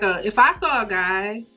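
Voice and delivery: usual speaking voice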